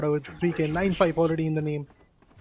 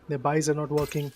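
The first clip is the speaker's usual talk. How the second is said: ordinary talk, nothing marked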